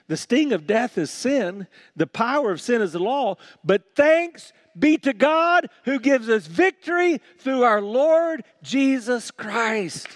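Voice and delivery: high, ringing pulpit proclamation; triumphant preacher's reading; rousing scripture reading. Real, not acted. First, rousing reading of the verse.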